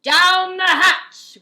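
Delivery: very loud, slow crew roar; rowdy pirate chorus; crew shout. Acted. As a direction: shout it back to the captain. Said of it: "we all shouted".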